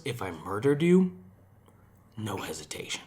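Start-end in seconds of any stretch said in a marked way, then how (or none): 2.2-3.0 hushed dark whisper. Pretend. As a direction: whisper it like a confession. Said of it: "murder for croquetas joke".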